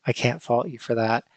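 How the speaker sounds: ordinary delivery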